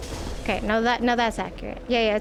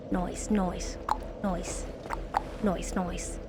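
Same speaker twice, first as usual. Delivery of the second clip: breathy whispered nice; 5 semitones lower, 8 dB quieter, much slower than usual. Real, not acted. Streamer reacting to chat, whispered nice. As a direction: hush the word nice.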